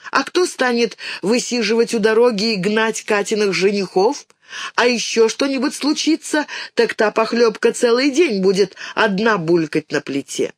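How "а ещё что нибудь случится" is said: ordinary delivery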